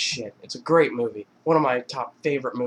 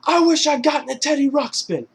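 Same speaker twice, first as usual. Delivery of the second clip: high, loud, emphatic yell; louder mock defiance; 13 semitones higher, 6 dB louder than usual. Mark Wahlberg's character defying Ted's dare, loud mock yell. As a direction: throw it back louder and much higher.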